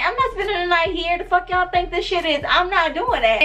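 high-pitched, mock-outraged protest; indignant refusal. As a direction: put your foot down, retelling a freak-out. Acting out her refusal to stay.

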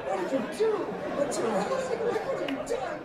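high, loud imitation of a horrified wife; mock aghast wife. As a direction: demand answers as an appalled wife, hammed-up. Imitates shocked wife questioning husband.

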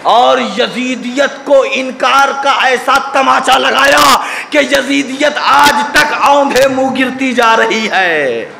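high, sustained preacher's shout easing slightly into ringing declamation; full-force sermon climax, pitched high; impassioned preaching. Real, not acted. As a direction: thunder it out to the congregation in rebuke.

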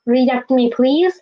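ordinary talk

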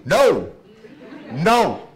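loud, insistent repeated no, as his son would; son's loud no. Retold or imitated. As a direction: shout it for the boy who can't speak. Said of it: "if my son could speak like this".

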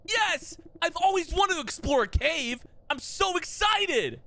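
put-on high, eager kid on an outing; high-pitched, overexcited delight, stretching the end; hammed-up excitement. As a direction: gush with exaggerated glee. Child excited about exploring cave.

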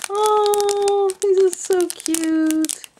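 high slow squeaky coo; squeaky gush. Real, not acted. Crafter showing little beads, high squeaky coo.

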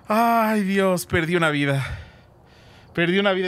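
ordinary talk, nothing marked

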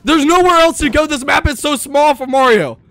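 high, loud, slowed panicked cry; panicked wail. Real, not acted. Player fleeing Mario, high loud panicked wail. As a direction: wail it in real dread. Mario breaks through again.

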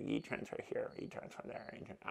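fading to a whisper; whispered muttering. Acted. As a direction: mutter it away to nothing. Muttering bank transfers.

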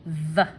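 "the" as a short buzzed th; voiced TH. Shown as a demonstration. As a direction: sound out "the" with voice, tongue between teeth. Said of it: "Hear the sound that your voice makes".

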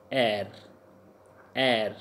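E U R said as "air."; sound demo for E U R. Shown as a demonstration. Teacher models French E U R for learners, pronounced "air.". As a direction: say "air." as one drawn vowel into R. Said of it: "pronounced as".

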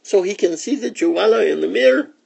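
high, quick little-sister voices; kitten sisters. A narrator's reading. Sisters answer Mama in story.

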